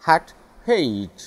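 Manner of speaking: hat short a, hate long ay; hat versus hate. Shown as a demonstration. Teacher modelling hat and hate. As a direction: contrast hat and hate clearly. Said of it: "एचएटी".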